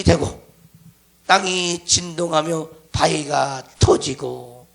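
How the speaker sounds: slow, loud, intoned verse; solemn scripture reading. Real, not acted. Preacher reading the torn veil passage, solemn proclamation. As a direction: proclaim it with long stretched phrase endings.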